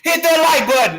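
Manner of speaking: very high, loud shouted demand; yelling. Real, not acted. Streamer demanding likes from viewers, high yelling. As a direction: yell it at the whole audience. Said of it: "I'm yelling at everybody".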